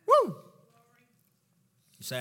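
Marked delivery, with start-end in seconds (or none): 0.1-0.4 loud, high "woo!"; excited whoop. Real, not acted. Preacher after declaring nothing can separate, excited whoop. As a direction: cap the climax with a whoop.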